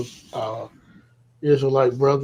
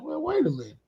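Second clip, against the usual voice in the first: much higher, softer, half-mocking objection; mock indignant protest; 11 semitones higher, 8 dB quieter, faster than usual. To his girlfriend, about the dude: high mock incredulous protest. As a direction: pitch it way up in mock disbelief. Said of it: "I'm like".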